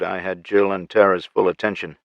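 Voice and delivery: ordinary delivery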